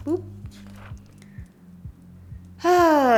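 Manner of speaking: ordinary delivery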